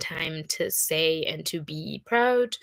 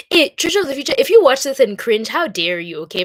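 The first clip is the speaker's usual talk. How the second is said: mock scolding; 6 semitones higher, 9 dB louder than usual. Mock scolding of future self.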